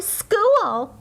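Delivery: high eager shout of joy; excited. Acted. Girl thrilled on her first school day, high and eager. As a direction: burst out with delight.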